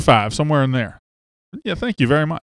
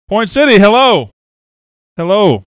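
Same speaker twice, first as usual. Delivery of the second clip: high, loud, excited greeting; hometown shout-out; 6 semitones higher, 6 dB louder than usual. Real, not acted. Host greeting viewers from his hometown, excited and loud. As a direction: call out happily to your hometown.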